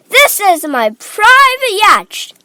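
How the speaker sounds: high, loud, drawn-out cry; Mister Wonka. A narrator's reading. Factory owner showing off his boat, crying out. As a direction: announce it grandly at full voice. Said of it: "cried Mister Wonka".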